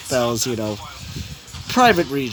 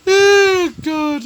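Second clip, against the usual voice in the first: laughing; 11 semitones higher, 5 dB louder than usual. Real, not acted. Laughing at own joke.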